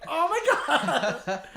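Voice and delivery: loud, high, drawn-out mock exasperation. Therapist reacting to patients asking if he's mad, mock exasperation. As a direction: throw up a long, loud, hammed-up protest.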